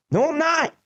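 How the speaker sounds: high-pitched imitation of a refusing girlfriend; squeaky, playful mimicry of her refusal; retelling how Adriana refused the kid, in a high mock voice; mock protest. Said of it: "She goes".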